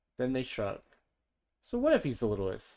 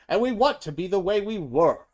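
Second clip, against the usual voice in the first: all together; 8 semitones higher, 10 dB louder than usual. A narrator's reading. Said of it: "says everyone together".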